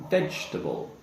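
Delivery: careful three-syllable VEGETABLE; correct pronunciation. Shown as a demonstration. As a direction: model VEGETABLE with silent middle "e". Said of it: "So we would say".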